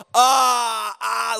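loud, high panicked "ah, ah" gag; frog-in-mouth gag. Retold or imitated. Retelling spitting out a frog, a loud disgusted gag. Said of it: "I'm literally sitting there going".

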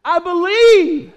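loud, high, drawn-out cry; full-voiced anguished exclamation. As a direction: cry it out as a desperate father. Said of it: "he's crying out".

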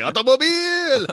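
loud, high comic movie-character cry of "Automobile"; Long Duck Dong. Acted. Teasing a co-host's way of saying "Automobile", loud high impression. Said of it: "like Long Duck Dong from Sixteen Candles".

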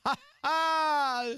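high, loud hammed-up "Ha ha!"; a fake rimshot laugh at his own pun; mock laugh.

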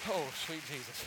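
winded after a jump; catching breath after exertion; puffed, recovering from a leap. Real, not acted. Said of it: "I'm out of breath".

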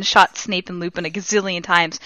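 ordinary talk, nothing marked

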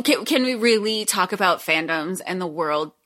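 ordinary delivery

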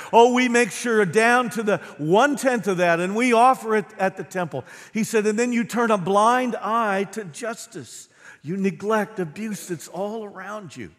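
loud, high, driving sermon delivery; preaching at full force. Acted. Pastor to congregation, voicing Jesus' woes, preaching at full force.

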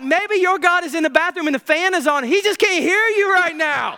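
high, laughing mock taunt. Elijah mocking Baal's prophets, laughing and high. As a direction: tease them about their god. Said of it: "He's like".

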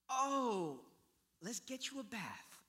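lightly hammed-up parental dismay; mock fussing father. Imagined father to dirty son, mock fussing.

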